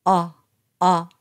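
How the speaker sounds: low-pitched "あ" between Japanese o and a; short, low "あ" with relaxed lips; open back vowel. Shown as a demonstration. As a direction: say "あ" from the back of the throat, jaw dropped. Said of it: "喉の奥の方で発声します".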